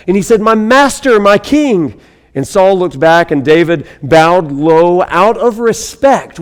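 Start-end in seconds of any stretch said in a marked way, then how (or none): 0.4-1.9 calling out. Retold or imitated. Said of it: "he yelled after Saul".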